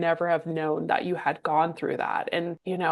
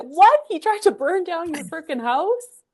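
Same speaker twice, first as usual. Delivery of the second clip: shocked disbelief; 11 semitones higher than usual. Real, not acted. Listener shocked by arson story.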